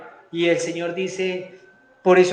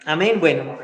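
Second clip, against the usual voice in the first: usual speaking voice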